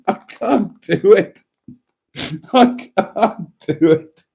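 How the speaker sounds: helpless, high laughing protest. Real, not acted. Vlogger failing to keep a straight face, laughing. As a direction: give up the act through laughter. Cracks up during her impression.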